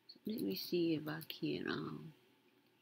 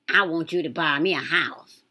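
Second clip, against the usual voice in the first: mock demand; 14 dB louder than usual. Mocking ex's demand.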